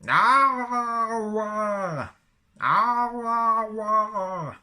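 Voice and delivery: high, loud, strained Wookiee howl twice; Wookiee roar. Shown as a demonstration. Showing a phrasebook's Wookiee word, a loud high howl. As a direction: throw your head back and howl like a Wookiee.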